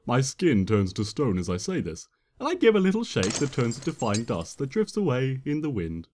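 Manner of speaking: plain reading voice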